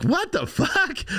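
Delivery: high, drawn-out incredulous question; baffled disbelief. Acted. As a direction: exclaim it slowly, pitch shooting way up. Acts out his baffled reaction.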